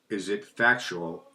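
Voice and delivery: slow, deliberate Is it factual, each word drawn out. Shown as a demonstration. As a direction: ask it slowly and carefully. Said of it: "that was slower than normal".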